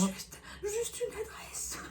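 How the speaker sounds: soft breathy whisper. Real, not acted. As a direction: whisper it aside. Whispered aside.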